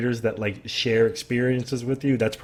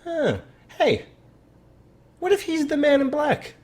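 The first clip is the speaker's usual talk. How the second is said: high, dawning eureka voice of a fan; hammed-up excited theorist; mock realization; 10 semitones higher than usual. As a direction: play the sudden lightbulb moment. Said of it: "someone else being like".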